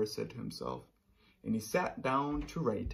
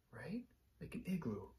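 soft unvoiced whisper; quiet confiding aside; 3 semitones higher, 12 dB quieter than usual. Shown as a demonstration. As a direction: hush it to the kids. Soft aside while signing.

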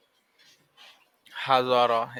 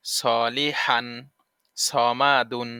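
slow drill; 2 semitones lower, much slower than usual. Shown as a demonstration. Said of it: "slow spelled drill".

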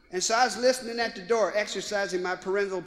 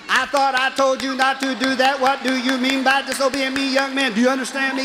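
a mama scolding mid-whipping, louder; 4 semitones higher, 6 dB louder than usual. Retold or imitated. Mother scolding her son while whipping him, loud scolding. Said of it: "She be saying".

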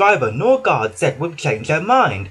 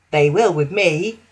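proud boast; 2 semitones higher than usual. Acted. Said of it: "said Daisy proudly".

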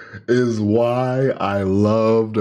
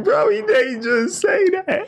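shocked crowd reacting to a savage line; mock crowd shout. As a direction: yell it like a stunned crowd. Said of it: "They in the crowd like".

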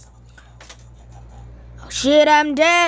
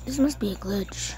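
hushed whisper to himself; 7 semitones lower, 10 dB quieter than usual. Real, not acted. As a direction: breathe it out unvoiced. Whispering to himself during game.

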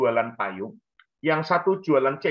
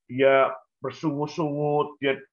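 usual speaking voice

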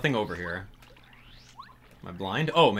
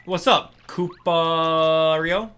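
slow, drawn-out and louder; character greeting; 5 semitones higher, 6 dB louder, much slower than usual. Acted. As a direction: stretch out the hello in a goofy voice.

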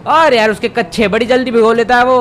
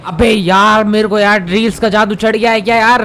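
usual speaking voice

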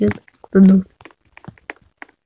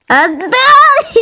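shrill demand; 11 semitones higher than usual. Real, not acted. Struggle over something.

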